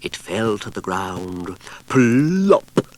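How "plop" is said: high, loud popped plop; plop sound. A narrator's reading. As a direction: pop the word like a falling pudding. Pudding hits the ground.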